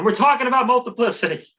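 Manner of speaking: high, loud hyped-up intro; mock show intro. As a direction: launch the show with over-the-top excitement. Mock show intro after countdown.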